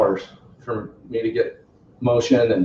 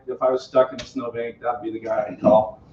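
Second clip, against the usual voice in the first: usual speaking voice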